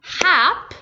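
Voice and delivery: Hap alone, high and crisp as one beat; teacher's demonstration of the syllable Hap; isolated syllable. Shown as a demonstration. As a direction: sound out Hap as a separate syllable. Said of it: "there is one vowel sound".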